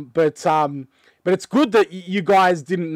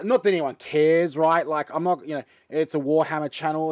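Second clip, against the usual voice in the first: ordinary talk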